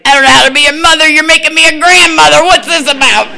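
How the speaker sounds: high, fast, loud flustered squeal; mock panicked new grandma. Woman telling her daughter she can't be a grandmother, high flustered squeal. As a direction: squeal it out like a panicking young mom. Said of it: "then I was like".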